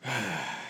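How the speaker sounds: heavy exhale; long sigh. Real, not acted. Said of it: "Long exhale".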